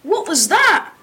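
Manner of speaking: high, loud startled question; mock scream. A narrator's reading. Pupil voices hero as TV smashes, screamed question. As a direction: cry it out, rising sharply at the end. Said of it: "I screamed".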